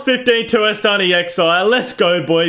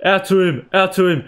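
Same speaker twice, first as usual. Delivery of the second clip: usual speaking voice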